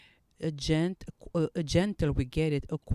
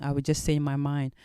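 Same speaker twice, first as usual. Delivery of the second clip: usual speaking voice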